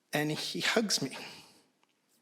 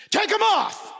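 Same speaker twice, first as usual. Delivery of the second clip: much higher, much louder yelled order; furious shout; 7 dB louder than usual. Retold or imitated. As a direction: bark it as a furious command. Dad repeats order, yelling.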